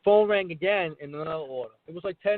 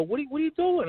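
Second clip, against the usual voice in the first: high-pitched, bemused question; mock bewilderment; retold puzzled customers; 11 semitones higher, faster than usual. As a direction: imitate baffled salon customers, pitched up. Voicing puzzled salon customers.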